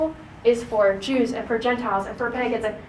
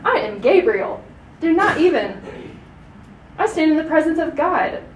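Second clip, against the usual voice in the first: higher, louder imposing angel; indignant angel; 4 semitones higher, 4 dB louder than usual. Shown as a demonstration. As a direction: rebuke him: how dare you doubt me. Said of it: "Kind of like in that tone".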